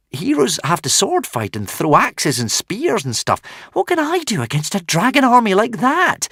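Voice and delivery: high, rising desperate plea. A narrator's reading. Hiccup protesting to the dragon, high and desperate. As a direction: plead in despair. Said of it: "Hiccup said in some desperation".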